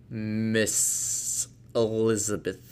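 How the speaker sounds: very slow, fading to a breathy end; slow reveal. Acted. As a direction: let the name out dreamily. Darcy names her, slowly.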